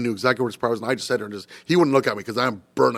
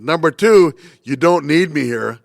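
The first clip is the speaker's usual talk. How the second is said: louder, slow counted point; pointed emphasis; 3 semitones higher, 6 dB louder, slower than usual. Retold or imitated.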